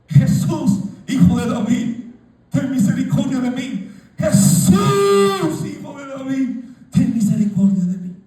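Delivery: "Jesús" cried very high and loud, building desperation. Retold or imitated. Blind Bartimeo shouting over the crowd, rising desperate cry. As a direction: beg across the crowd with all your voice. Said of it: "gritaba y gritaba".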